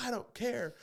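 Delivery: high-pitched petulant retort; imitated meltdown. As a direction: mimic her losing it. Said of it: "she lost her shit".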